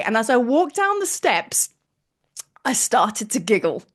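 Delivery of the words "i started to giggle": usual speaking voice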